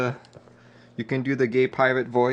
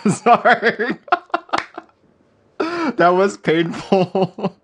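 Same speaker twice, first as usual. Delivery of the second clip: helpless laughter breaking through the words, higher and louder; laughing apology; 9 semitones higher, 5 dB louder, much slower than usual. Real, not acted. Streamer cracking up after own painful pirate voice, laughing. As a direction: crack up while trying to talk.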